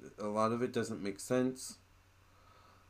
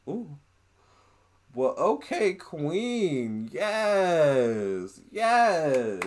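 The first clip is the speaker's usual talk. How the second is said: excited hype; 5 semitones higher, 6 dB louder, much slower than usual. Real, not acted. Hyping up Cleopatra's answer.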